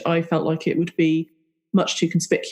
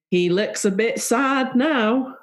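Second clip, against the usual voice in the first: quoted quip; 7 semitones higher, slower than usual. Retold or imitated. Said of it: "that you could say".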